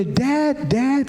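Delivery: high-pitched mimicked cry for a parent; imitated child's call. Father acting what he thought his child called, high cry. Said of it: "Saya pikir dia panggil".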